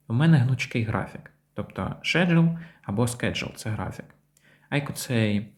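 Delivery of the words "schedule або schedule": "schedule" first with sh, then with sk; two variants. Shown as a demonstration. Teacher offers learners both versions, British then American pronunciation. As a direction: say "schedule" as SHED-jol, then SKED-jol. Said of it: "є більш британською вимовою".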